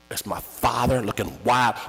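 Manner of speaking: ordinary talk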